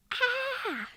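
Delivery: high-pitched acted scream of fright; short storybook scream; startled scream. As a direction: shriek as if someone jumped out. Henry is scared by Jet.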